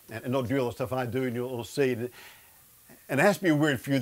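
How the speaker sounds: ordinary talk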